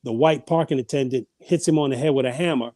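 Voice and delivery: ordinary talk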